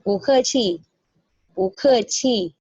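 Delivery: "chi" light and short; slow "bu ke chi", barely touch "chi"; neutral tone demo. Shown as a demonstration. Teacher repeats phrase slowly for class. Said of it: "chi-nya itu ringan".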